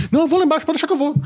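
high, eager, overexcited. Retold or imitated. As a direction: squeak it out eagerly. Retold own eager quote.

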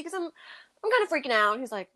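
ordinary talk

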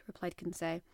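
ordinary delivery

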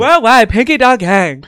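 high, loud, hyped-up streamer voice building up; Pinky Doll impression. Hosts quoting Pinky Doll's origin story, high and hyped. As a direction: belt it out like a peppy NPC streamer. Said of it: "actually, she said".

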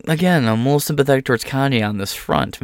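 normal talking voice

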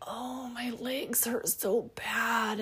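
soft, high, slow moan; mock complaining. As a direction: moan about sore muscles, playfully drawn out. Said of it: "You know, we complain".

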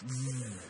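low, soft buzzing hum; Star Trek transporter noise. Retold or imitated. Jesus materializes like in Star Trek, imitated buzz. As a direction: buzz like a sci-fi teleporter.

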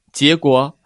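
ordinary delivery

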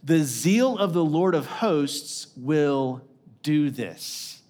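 "The zeal of the Lord of hosts" drawn out slowly, pause before "do this"; slow right down and weigh each word through "will do this"; emphatic scripture reading. Real, not acted. Preacher reading the end of verse seven, slow emphasis on "zeal" and "do this". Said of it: "emphasis on this last part".

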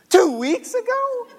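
very high, loud, stretched question; shocked disbelief. Retold or imitated. Dad hears how long son hid it, high shocked disbelief. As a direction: squeal the question in astonishment.

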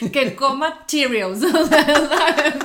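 slow words dissolving into laughter; laughing through a joke. Real, not acted. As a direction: chuckle out the punchline, slowly. Joking line breaks into laughter.

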